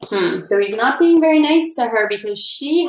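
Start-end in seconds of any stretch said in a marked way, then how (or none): none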